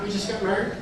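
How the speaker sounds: small, high, soft voice; mock-timid little confession; sheepish reply. Meek answer to father-in-law.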